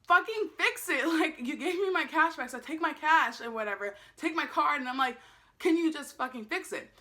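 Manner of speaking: re-enacted heated outburst; retold angry yelling. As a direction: demand it, fed up and worked up. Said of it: "because I'm yelling at this point".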